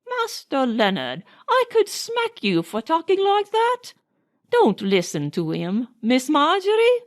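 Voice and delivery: a hint of a scolding old nanny. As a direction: fondly threaten the boy. Nanny scolds Leonard playfully.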